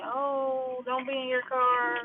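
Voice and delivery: lightly put-on scolding woman; mimicked nagging lady. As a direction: mimic a busybody telling you off, high and soft. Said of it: "trying to tell me".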